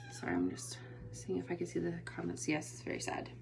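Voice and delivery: whispered aside while reading comments; whispering. Real, not acted. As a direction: whisper as you scan the chat.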